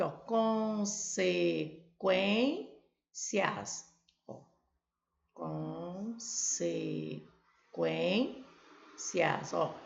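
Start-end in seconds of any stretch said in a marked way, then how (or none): 0.1-3.9 consequências stretched slowly into syllables. Shown as a demonstration. Teacher reviewing the split from earlier. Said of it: "nós vimos aqui". 5.3-9.5 consequências stretched slowly into syllables. Shown as a demonstration. Teacher reviewing the split from earlier. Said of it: "nós vimos aqui".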